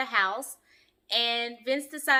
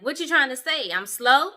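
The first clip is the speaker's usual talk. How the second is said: louder, defensive imitation of taking offense; mock popping off; 6 dB louder than usual. Vince snapping at Bree over her joke, imitated outburst. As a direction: fire it back loudly, as if insulted. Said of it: "Like, dude popped off".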